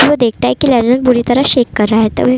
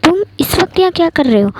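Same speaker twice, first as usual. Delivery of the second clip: higher, startled question; shocked; 6 semitones higher than usual. A narrator's reading. Lan Zhan startled when Wing climbs in the window, shocked. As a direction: caught off guard at night. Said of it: "लैनजैन shock होते हुए कहता है".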